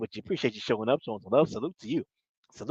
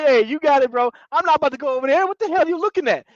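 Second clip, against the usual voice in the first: mock incredulous; 7 semitones higher, faster than usual. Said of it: "Jermaine is like".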